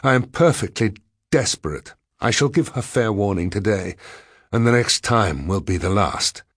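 plain reading voice

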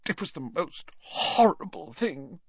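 put-on high, tearful old professor. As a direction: speak through brimming tears. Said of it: "eyes brimming with tears".

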